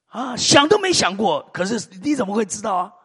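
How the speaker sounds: normal talking voice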